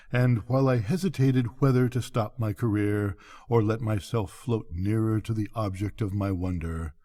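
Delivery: usual speaking voice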